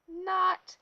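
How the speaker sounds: loud, high shouted "Not!"; yell. A narrator's reading. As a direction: shout it out in disbelief. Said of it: "waited for someone to yell".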